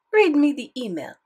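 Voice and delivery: French grandmother, insistent; French accent. Acted. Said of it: "thick French accent".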